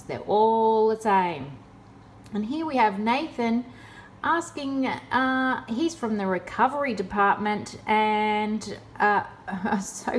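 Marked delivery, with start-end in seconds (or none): none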